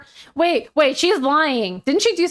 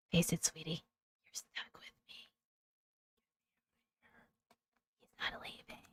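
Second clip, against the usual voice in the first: trailing down into a whisper; hushed mock-ominous aside to a sweetheart; teasing whisper; 20 dB quieter than usual. Real, not acted. Wife teasing her reader sweetie, mock-sinister whisper.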